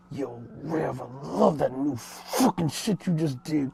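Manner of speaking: spitting, forceful impression of a friend; Headquarters, forceful. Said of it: "spit will come out of his mouth".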